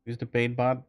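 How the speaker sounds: ordinary talk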